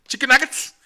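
high, loud goofy voice; silly voice. Pretend. Joking to his friend about an old running gag, in a high, loud goofy voice. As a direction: squeal it out like a joke catchphrase. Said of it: "un día de mame".

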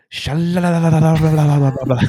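high, loud gibberish chant; mock preacher rattling off tongues; speaking in tongues. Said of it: "speaking in tongues".

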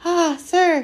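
high, loud elf gasp; gasping house-elf. A narrator's reading. Tearful elf overcome before Harry, gasping. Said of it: "he gasped".

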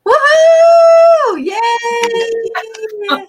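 high, loud whoop of celebration; excited cheer. Real, not acted. Cohost cheering the new blog launch, whooping loudly. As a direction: cheer it like big news just dropped.

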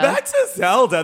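high, loud playful echo; snapping back on track; lively echo. Real, not acted. Echoing her co-host, high and loud, to get back to Zelda.

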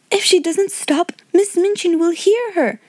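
high-pitched, worried schoolgirl; urgent cry. A narrator's reading. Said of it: "cried Jessie".

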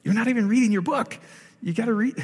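frustrated. Retold or imitated. Preacher to congregation, exasperated and pitched high. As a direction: urge them to just read the book. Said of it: "I get frustrated 'cause I'm like".

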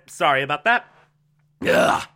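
coughing fit, then recovering; choking and clearing the throat; choking on a drink. Real, not acted. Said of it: "merely choking to death".

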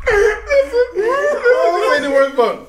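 high squeaky laugh-crying through the words; can barely get it out for laughing; laughing hysterically. Real, not acted. Telling friends a story, cracking up uncontrollably. Laughing so hard he can't talk.

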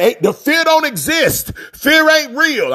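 high, slow scoffing voice; mock someone waving fear away; mock dismissive quote. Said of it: "Ain't nobody saying".

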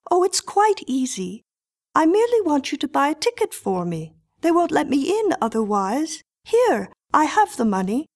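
put-on high, quick, composed child voice; a cool little girl asking politely. Miriam's lines voiced higher.

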